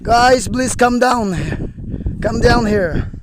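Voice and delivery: slow, high, loud shout; calling out. Real, not acted. Climber calls the group to come down, shouting. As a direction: holler with drawn-out words to carry far.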